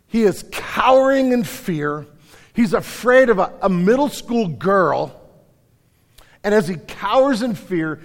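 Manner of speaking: impassioned sermon storytelling; forceful preaching. Real, not acted.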